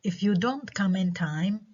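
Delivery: slow clause rising on "time"; rising intonation. Shown as a demonstration. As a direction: leave "time" hanging, unfinished.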